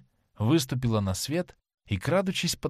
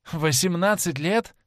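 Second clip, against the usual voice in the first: laughing question; 6 semitones higher, 3 dB louder than usual. A narrator's reading. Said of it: "рассмеялся Дориан Грей".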